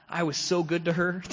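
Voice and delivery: put-on sending up his old self; sarcastically proud; mock self-praise. Mocking his old self-image.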